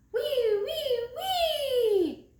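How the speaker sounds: high, loud wheezing wee-wee; snoring noise. Retold or imitated. Big Mouse's snore, high and loud. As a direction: imitate a whistling snore. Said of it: "you're snoring".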